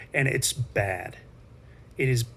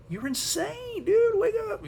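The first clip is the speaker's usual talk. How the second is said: exasperated yell. Retold or imitated. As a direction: squeal at him to wake up, much higher. Yelling at a sleeping neighbour.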